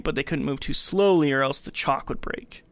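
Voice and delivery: ordinary delivery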